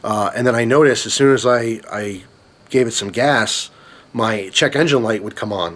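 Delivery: normal talking voice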